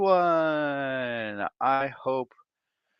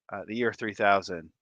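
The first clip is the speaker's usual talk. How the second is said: normal talking voice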